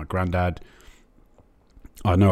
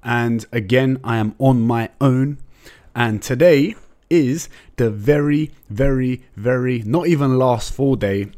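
slow, louder hyped announcer welcome; show announcer intro; 5 semitones higher, 5 dB louder, slower than usual. Acted. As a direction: roll it out like a show opener.

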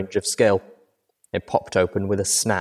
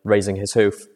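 ordinary delivery

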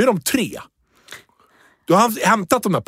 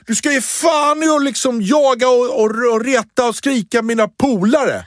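slowed-down, pitched-up mock shout; kid shouting; 8 semitones higher, slower than usual. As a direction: play the angry boy yelling at a grown-up. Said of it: "ropar han".

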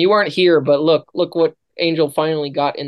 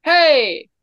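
shout; 10 semitones higher, 4 dB louder than usual. Pretend. Ends silly song with shout.

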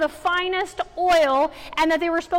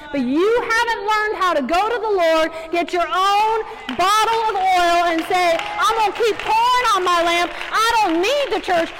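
louder, driving preacher voice; fired-up exhortation; preaching at full force; 5 dB louder than usual. Acted. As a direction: press the point hard on the congregation. Preaching push.

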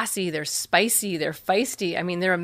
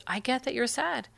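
soft, gentle and quieter; trusting reassurance; 5 dB quieter than usual. Shown as a demonstration. Parent showing trust to a sad child, softly. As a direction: calmly show faith in the child. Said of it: "there's a look on your face".

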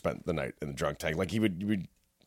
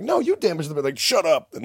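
mock indignant retort; 8 semitones higher, 5 dB louder than usual. Arguing with cops.

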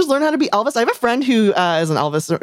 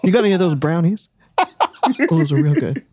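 sultry [name] voice; 4 semitones lower than usual. Pretend. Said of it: "deep, sultry voice".

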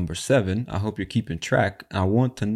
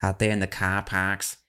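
imitated Boston accent, car park with no R. Host mimicking the man from the clip, Boston accent. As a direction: put on a Boston "cah pahk". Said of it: "'cause he said car park".

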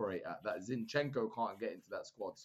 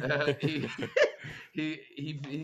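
laughing; slower than usual. Real, not acted. Laughing at own Dutch voice.

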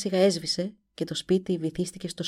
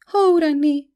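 high, loud gasping cry; cry of horror; 10 semitones higher, 9 dB louder than usual. A narrator's reading. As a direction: cry out, turning pale with fear. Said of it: "φώναξε η Ντομίνικα". (κραυγή τρόμου)

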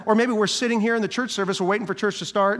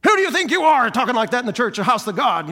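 indignant, louder scolding rebuke; mean rebuke; 5 semitones higher, 6 dB louder than usual. Pretend. Pastor mocking a harsh church member's rebuke, loud and indignant. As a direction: tear into them like a self-righteous scold. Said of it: "we don't be mean".

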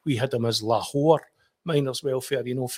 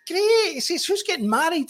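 Ian, delighted; 4 dB louder than usual. Retold or imitated. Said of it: "And he says".

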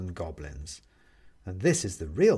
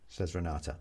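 ordinary delivery, nothing marked

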